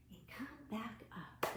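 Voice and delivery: soft whisper. Acted. Librarian whispers to the children in the wind game, whispered. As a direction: hush them back up.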